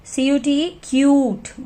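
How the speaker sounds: क्यूट with a long यू, slow spelling; slow teacher demo, long यू in क्यूट; long vowel demo. Shown as a demonstration. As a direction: spell slowly then stretch यू in क्यूट. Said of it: "यू की sound क्यूट और आई silent".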